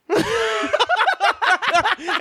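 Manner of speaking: high, shrieking laugh; cackling laugh. Real, not acted. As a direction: crack up hard at the punchline. Big laugh after the punchline.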